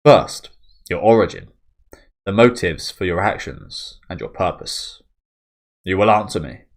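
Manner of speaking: loud, sharp demands; interrogating commander. Acted. Farnese interrogating Guts, loud and demanding. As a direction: bark the questions at the prisoner.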